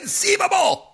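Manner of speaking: a hammed-up imitation of the sputtering schemer; Vizzini impression. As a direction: shoot way up in pitch, indignant. Quoting Vizzini's catchphrase.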